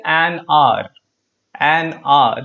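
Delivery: "an hour" linked as "an ar"; silent H. Shown as a demonstration. Teacher giving the right form, "an hour" with silent H. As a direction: say "hour" with no H, straight after "an". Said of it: "Again, the H is silent".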